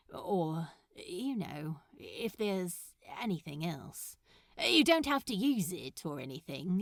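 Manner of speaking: lightly voiced nervous suitor, halting and hesitant; shy Crowley. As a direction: fumble through the offer, trailing off. Crowley offers number shyly.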